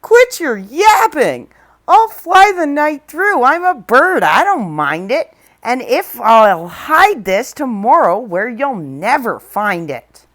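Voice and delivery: calling back. Acted. The eagle taunting Horton from the air, loud call. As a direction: yell back while flying off. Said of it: "over his shoulder called back".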